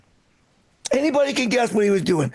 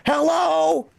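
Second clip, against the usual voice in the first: high, drawn-out mock call; mother-in-law calling out; 7 semitones higher than usual. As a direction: imitate a confused old lady calling into space. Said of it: "she just randomly calls out".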